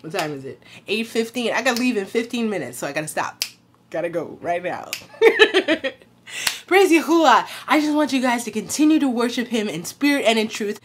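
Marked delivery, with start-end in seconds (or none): 3.9-5.9 laughing. Real, not acted. Laughs while rushing off.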